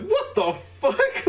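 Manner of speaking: high, slow, drawn-out disbelief; retold shock. Moviegoer recalling looking at a friend in theater, retold shock. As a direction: stretch it out high, replaying your astonishment.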